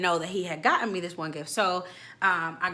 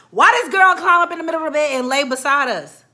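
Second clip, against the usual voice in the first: loud, higher, indignant cry; outraged shout; 7 semitones higher, 12 dB louder than usual. Real, not acted. Telling viewers about the friend in bed, loud outrage. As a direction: belt it out in disbelief.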